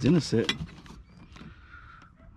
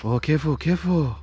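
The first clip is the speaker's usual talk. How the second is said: louder, higher, calling out a warning; 5 semitones higher, 5 dB louder than usual. Real, not acted. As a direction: call out careful to them. Warning someone not to spill.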